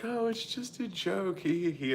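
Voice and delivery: very high, giggly mock voice; mocking her giggle. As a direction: play her as a tittering airhead. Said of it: "she was like".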